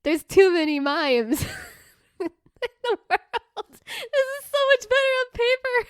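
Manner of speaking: high squeal of laughter through the words; really cracking up while talking; helpless laughter. Real, not acted. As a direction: barely get the joke out for laughing. Cracks up reading own joke.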